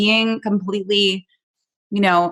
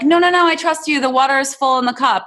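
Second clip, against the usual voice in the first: eager insisting; 6 semitones higher than usual. Retold or imitated. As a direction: insist you're fine, overeager. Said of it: "I was like".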